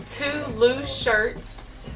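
ordinary talk

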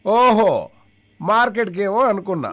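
a deaf villager hollering a question to a deaf friend; deaf man shouting. Retold or imitated. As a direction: shout as if the other can't hear. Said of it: "ఇద్దరు చెవిటి వాళ్ళే".

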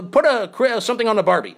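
put-on stumbling stab at an Australian accent; attempted Australian accent. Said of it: "I can't do an Australian accent".

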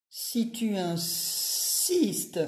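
very slow, drawn-out snake voice; slow snake drawl. Acted. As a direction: stretch every word lazily. Boa answers slowly.

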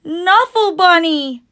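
high, loud, slowed cry of delight; joyful exclamation. Acted. Trixie spotting her lost bunny on the plane, delighted loud cry. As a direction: shout it like finding a lost treasure.

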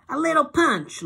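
high, loud slurred words. A narrator's reading. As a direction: drunk and slurring. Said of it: "her voice is slurpy".

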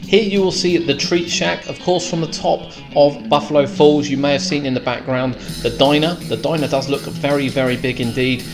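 ordinary talk

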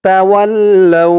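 তাওয়াল্লাউ drawn out with doubled ল্ল; held ল্ল in তাওয়াল্লাউ; slow recitation. Shown as a demonstration. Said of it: "লাম দিয়ে ওয়াও ধরবে".

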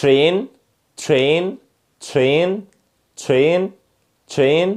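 "Train" starting like "chrain"; "Train" with a "chr" onset; correct American TR. Shown as a demonstration. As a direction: say "Train" with a sh sound between t and r. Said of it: "يلا مع النطق الصح".

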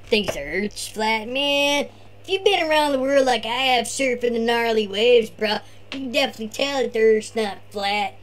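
laid-back surfer bro; be the chill beach surfer dude; Tony surfer voice. Acted. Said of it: "turn this over to Tony".

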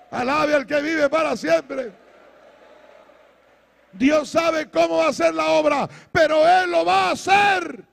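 loud exhortation climbing to high shouted praise; full-force preaching. Real, not acted. Preacher urging a congregation to praise God aloud, full-force preaching.

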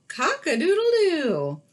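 high, loud rooster crow. Acted. Teacher voicing the rooster for the children, high crow.

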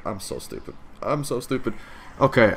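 ordinary talk, nothing marked

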